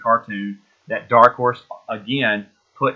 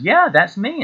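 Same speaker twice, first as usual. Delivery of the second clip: proud animator; 10 semitones higher, 4 dB louder, slower than usual. Retold or imitated. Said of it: "He goes".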